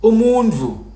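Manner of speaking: nasalized "Umundvu"; the Liswati way, nasal sound in "Umundvu"; Liswati pronunciation. Shown as a demonstration. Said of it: "Liswati will say".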